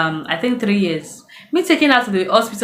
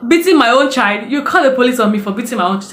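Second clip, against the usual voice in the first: loud, higher outraged question; a mother outraged that the neighbour threatens police; indignant retort; 4 semitones higher, 4 dB louder than usual. Retold or imitated. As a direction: protest loudly in disbelief.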